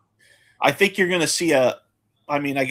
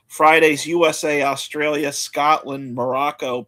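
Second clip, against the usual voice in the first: usual speaking voice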